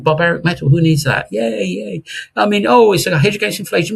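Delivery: lightly mock the doubters; mocking gold sceptics.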